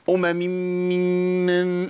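أمم ممن as one long hum with no breaks; wrong merged m. Shown as a demonstration. Teacher mocking the merged reading of أمم ممن. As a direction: blur the m's of أمم ممن into a single drone. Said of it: "إيه يا عم في إيه؟".

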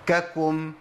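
ككم chanted as ka-kum; both letters of ككم sounded separately; two voweled kafs. Shown as a demonstration. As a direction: say ككم with an open ka, then kum. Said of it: "عندي كاف مفتوحة وكاف مضموم".

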